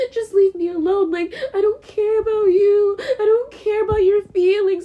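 crying outburst. Real, not acted. Lashing out at his mother while crying. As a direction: push the words out through crying.

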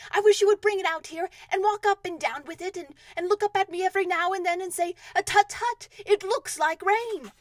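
high, loud instructions from above; calling down. Acted. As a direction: call the plan down to a helper below. Pooh calls instructions down from balloon.

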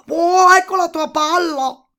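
high, very loud toad call; triumphant toad. A narrator's reading. Toad handing the princess her ball, triumphant loud toad voice.

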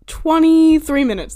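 mock shocked. Real, not acted. Shocked at her own ramble length.

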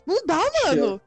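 ordinary talk, nothing marked

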